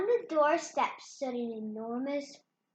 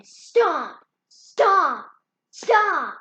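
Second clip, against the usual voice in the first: each stomp louder, booming; 5 semitones higher, 12 dB louder, much slower than usual. Acted. Reading the giant's footsteps to the listener, booming stomps. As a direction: thump out the giant's steps.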